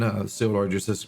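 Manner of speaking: ordinary talk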